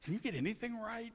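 retold exasperated self-scolding, rising at the end; mock berating of himself. Man scolding himself over a stupid mistake, exasperated self-berating. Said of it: "just saying this to myself".

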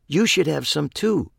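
chirpy high voice; squeaky voice. Acted. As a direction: offer it in a cartoon squeak. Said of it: "in her new squeaky voice".

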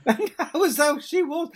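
words spoken through a high loud laugh. Real, not acted. Recalling her mother's wit, laughing loudly. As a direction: laugh as you say it.

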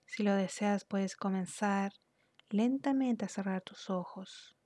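unhurried, soothing instructions that ease the listener into relaxation; guided meditation. Real, not acted. Guide leads listener through relaxing the body, calm guided meditation.

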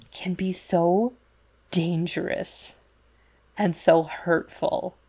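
slow, weighted "so dangerous and so hurtful"; slow emphasis. Real, not acted. Host stresses to her co-host how hurtful tradition can be, slow. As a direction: drag the words out to make them land.

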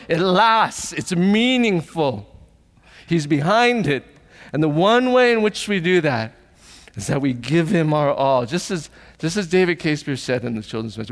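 normal talking voice

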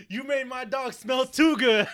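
furious shout. Acted. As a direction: bellow it like a man avenging his dog. John Wick furious over groomed dog.

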